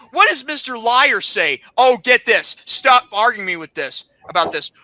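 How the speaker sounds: ordinary talk, nothing marked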